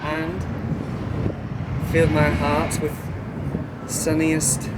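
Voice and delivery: halting mock love-poem recitation; old-fashioned poetry recital. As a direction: declaim improvised antique verse, pausing between phrases. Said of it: "old-fashioned poetry".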